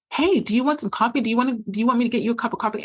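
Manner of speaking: high, fast, insistent mock offer; imitating pestering partner. Mimics partner pestering her about coffee.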